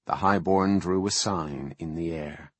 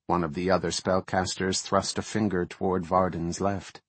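ordinary delivery, nothing marked

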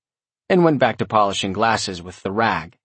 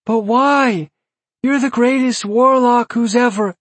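high breathy little demon; adoring squeaky imp; whiny demon voice; 5 dB louder than usual. A narrator's reading. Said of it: "breathy, whiny voice".

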